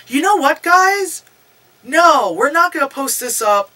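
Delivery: high, emphatic call to viewers; mock vlogger announcement. Storyteller mimics heroine recording her vlog, mock emphatic announcement. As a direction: imitate a vlogger addressing fans.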